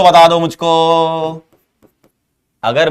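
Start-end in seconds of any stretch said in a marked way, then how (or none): 0.0-1.4 slow prompt, final मुझको held long; drawn-out prompt. Real, not acted. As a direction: call to the class, stretching मुझको on one held note. Calls on class to answer.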